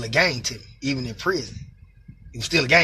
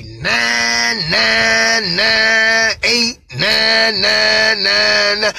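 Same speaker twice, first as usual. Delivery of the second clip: slow disbelief; 5 semitones higher, 4 dB louder, much slower than usual. Retold or imitated. Reading out shocking sheet numbers.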